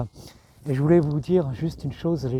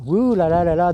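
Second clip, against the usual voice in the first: exasperated ouh là là; 4 semitones higher, 6 dB louder than usual. Real, not acted. Dismayed exclamation about war.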